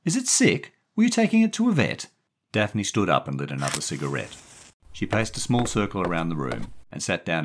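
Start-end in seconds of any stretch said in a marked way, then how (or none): 0.0-2.1 put-on high, loud child's questions, quickening; puzzled little boy. A narrator's reading. Confused Stevie asking Daphne about the cat, puzzled little boy. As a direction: ask innocently in a boy's high voice.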